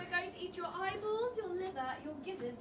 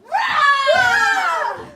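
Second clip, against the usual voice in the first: loud high troll roar; monster roar; 8 semitones higher, 18 dB louder than usual. Acted.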